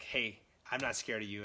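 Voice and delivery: ordinary talk, nothing marked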